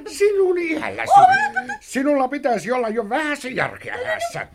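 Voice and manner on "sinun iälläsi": high, scornful outburst; scornful shout. Acted. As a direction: shout it in disgust at her. Scolding sister, shouted. (veli huutaa sisarelleen halveksien)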